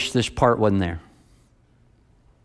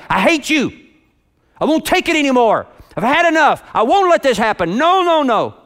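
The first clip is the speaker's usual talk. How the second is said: higher, louder furious shout; screaming at his wife; 11 semitones higher, 4 dB louder than usual. Retold or imitated. Husband at his breaking point screaming at his wife, furious shout. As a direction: hurl it at her, at the breaking point. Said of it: "he screamed at his wife".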